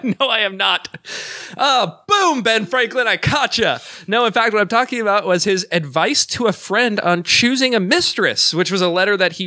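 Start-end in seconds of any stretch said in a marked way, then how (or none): 1.6-3.8 high, gleeful gotcha; triumphant gotcha. Real, not acted. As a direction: crow over catching him. Host catches guest in lie.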